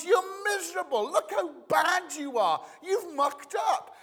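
high-pitched scolding God; hammed-up fault-finding voice; judging God. Voicing a harsh judging God.

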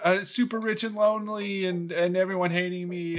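mock pleading. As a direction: beg in exaggerated distress, pitched well up. Scrooge pleads not to die.

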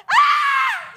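high shrieking scream of fright; retold scream. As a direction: shriek as the mouse runs over your feet. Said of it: "jumped on the water bed and went".

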